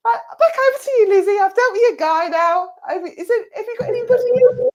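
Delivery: high, loud, quick and laughing; laughing handover. Real, not acted. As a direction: giggle as you hand back to Lizzie. Medium hands over, laughing.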